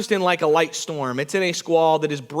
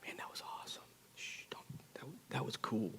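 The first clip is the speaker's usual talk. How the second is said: whispered, secretive praise; quiet admiration; 9 semitones lower, 26 dB quieter, faster than usual. Retold or imitated. Every single guy privately to [name], hushed whisper. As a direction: whisper it so nobody overhears. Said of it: "walks up to me real quietly".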